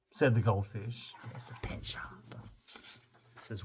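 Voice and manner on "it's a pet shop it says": soft unvoiced aside; whisper aside. Real, not acted. Reader whispering an aside about the pet shop. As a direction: whisper it to the listener. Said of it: "whispered aside".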